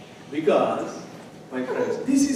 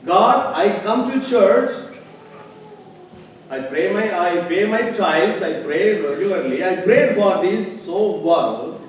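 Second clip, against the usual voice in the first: loud, slow, high bargaining with God; mock bargaining prayer; 6 semitones higher, 11 dB louder, slower than usual. Preacher mimics a churchgoer demanding payback from God, loud and slow. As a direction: plead slowly and loudly like a customer owed a deal.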